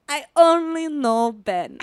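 very slow, mock-seductive Italian accent; effortlessly sexy. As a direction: draw it out like an unbothered flirt. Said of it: "in the most, like, sexy, but, like, not even trying hard way".

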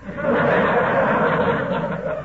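airy, breathy embarrassed laugh; embarrassed wheezing chuckle; sheepish laugh. Acted. McGee laughs it off.

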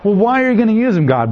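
high, fast incredulous question; Habakkuk's exasperation. Retold or imitated. Habakkuk pressing God, high and fast in mock disbelief. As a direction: fire it off, pitch up in disbelief. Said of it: "Habakkuk questioning God".

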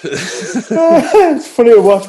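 speaking through a laugh; amused, laughing hard. Real, not acted. Laughing at cramp.

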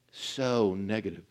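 ominous slow drop; dark and low. Shown as a demonstration. Preacher sets good against negative emotions.

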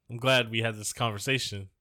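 ordinary delivery

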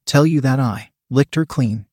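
ordinary delivery

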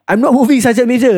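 high, pleading "I'm not moving"; whiny kid protest. Retold or imitated. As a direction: squeak it like a scared cadet. Kid protests to sergeant major.

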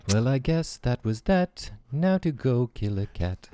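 the rejected guy in a slow put-on voice; deadpan guy voice. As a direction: drawl it out slowly in a put-on voice. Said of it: "and you're like".

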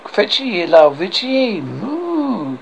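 slow mock French order, then a cow's moo; French accent. French guard calls for the cow, drawn-out French accent. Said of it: "French guard".

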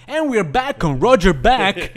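high, loud, slow host voice; ad-break announcer. Pretend. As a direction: announce the show's return with mock fanfare. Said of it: "you can be like".

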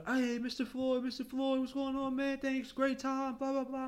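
high-pitched, drawn-out party greeting; cheery passengers. Retold or imitated. Wedding party hailing their shuttle driver, high and cheery. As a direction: imitate merry passengers in a high voice.

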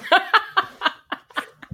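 sudden high burst of laughter; laughing. Real, not acted. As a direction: crack up laughing. Laughs after "I'm gonna shut up.

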